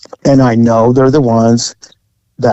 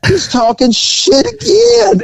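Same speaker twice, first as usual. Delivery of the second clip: excited retelling; 11 semitones higher than usual. Retold or imitated. Retelling the laughing crowd.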